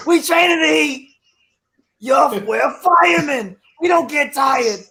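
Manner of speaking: loud hammed-up fighter bravado; tough fighter boast. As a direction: hype it up like a boxer. Imitating boxers' tough talk.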